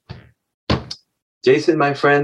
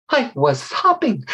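put-on slow, high child voice with a hiss; little snake; 9 semitones higher, 3 dB quieter, slower than usual. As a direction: confess as a small hissing snake. Little snake answers mother.

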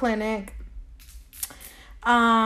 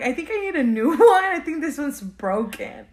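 laughing mock denial; 5 semitones higher, 4 dB louder than usual. Mocking her own denial.

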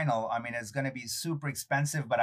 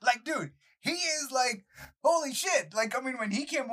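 amazed; 10 semitones higher than usual. Real, not acted. Amazed retelling.

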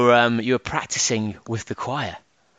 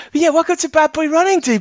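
much higher and louder show intro; 5 dB louder than usual. Real, not acted. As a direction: jump up into a high voice. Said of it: "alternate between extremely high and extremely low".

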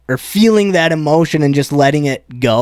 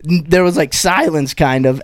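ordinary talk, nothing marked

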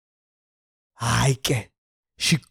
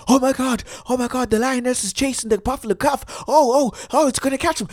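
much higher, fast breathless sports commentary; excited commentator; 8 semitones higher, faster than usual. Retold or imitated. Narrating the lion chase like a thrilled commentator. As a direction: call the chase like a frantic commentator. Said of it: "ngathi commentator".

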